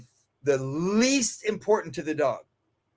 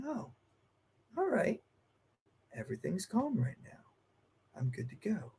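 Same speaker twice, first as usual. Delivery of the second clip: lightly voiced relaxed dog, softer; a dog calming down, content; 3 semitones higher, 14 dB quieter than usual. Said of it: "they'll be like".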